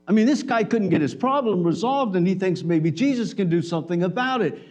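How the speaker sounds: usual speaking voice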